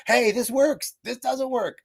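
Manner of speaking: high, bright mock presenter; playful pitch up announcing results; chirpy announcer. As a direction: sing it out like a cheerful teacher. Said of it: "come here and be like".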